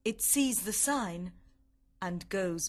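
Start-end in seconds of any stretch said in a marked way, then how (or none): none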